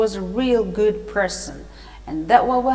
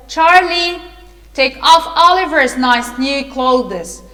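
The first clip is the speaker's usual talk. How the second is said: loud, higher-pitched acted command; raised storybook voice giving orders; calling out orders; 3 semitones higher, 7 dB louder than usual. A narrator's reading. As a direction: call to an underling with an order.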